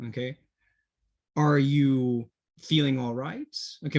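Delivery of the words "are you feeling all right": slow, clear model question; slow teacher demo. Shown as a demonstration. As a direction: say it slowly so students can copy. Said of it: "we can say".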